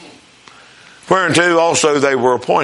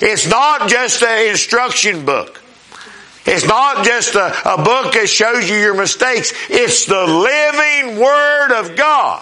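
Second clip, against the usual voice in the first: slow, higher-pitched preacher's proclamation; rousing sermon climax, words drawn out; emphatic preaching; 5 semitones higher, slower than usual. Real, not acted. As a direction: proclaim it to the congregation, stretching key words.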